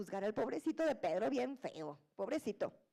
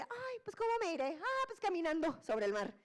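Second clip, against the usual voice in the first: carefree Jesus; 4 semitones higher than usual. Acted. Jokey retelling of Jesus walking.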